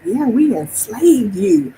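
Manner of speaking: slow, lightly put-on voice; a hint of a mocking boast; mock slaveholder voice. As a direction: drawl it out like a smug descendant. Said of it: "and they're like".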